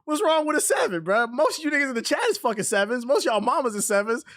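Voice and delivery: high, laughing disbelief; incredulous laughing rant. Real, not acted. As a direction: go way up in disbelief and keep pushing.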